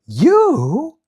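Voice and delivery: high, loud shocked cry; disbelieving outburst; surprised. A narrator's reading. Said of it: "exclaimed Dorothy".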